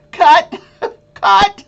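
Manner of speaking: high, loud mock-shocked time out; calling a halt in mock panic, high and loud; mock alarm.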